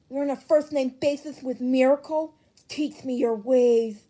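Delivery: loud, pleading, slowing at the end; eager envy. Acted. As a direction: beg for the secret. Grape Juice begs in chat.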